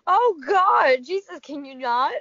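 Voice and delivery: loud, appalled groan of disbelief; loud disgusted outcry. Real, not acted. Listener hears of coworker clipping toenails, loud disgusted outcry. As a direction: recoil out loud like you smelled it.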